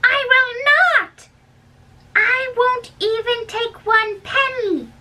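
shocked nurse refusing a bribe; indignant refusal. Acted. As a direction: refuse the money hotly, pitch way up.

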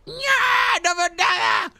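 exasperated high squeal at a blurred screen; hammed-up squeaky wail; high-pitched squeal. Mock cry at blurry screen.

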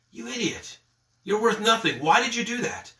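a hint of the evil one berating you; lightly voiced accusing tempter; accusatory voice. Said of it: "this accusatory voice".

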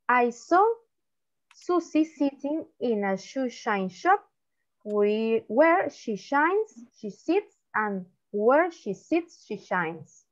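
tongue twister read slowly, phrase by phrase; slow demonstration. Shown as a demonstration. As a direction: model it slowly, pausing between phrases. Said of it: "me van a decir rápido". (trabalenguas leído despacio y por partes)

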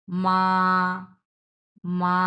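"মা" held long on one level pitch; sustained, unwavering level tone on "মা"; flat first tone. Shown as a demonstration. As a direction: draw "মা" out evenly from end to end. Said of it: "ফ্ল্যাটভাবে উচ্চারণ করছি".

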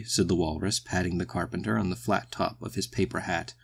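usual speaking voice

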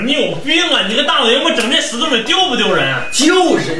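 ordinary talk

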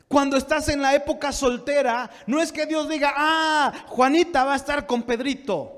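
high, loud pulpit teaching; forceful preaching. Real, not acted. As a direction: drive each point home to the congregation. (prédica enérgica, aguda y fuerte)

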